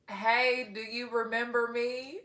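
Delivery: slow, drawn-out Texas country accent; hammed-up Texas country twang. Shown as a demonstration. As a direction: read it out in a put-on country accent. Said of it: "This is my country accent".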